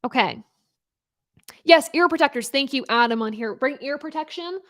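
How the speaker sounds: normal talking voice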